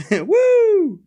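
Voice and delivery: very high whoop between laughs; high drawn-out whoop breaking into laughter; celebratory whoop. Real, not acted. Streamer whoops over twenty bucks won, excited.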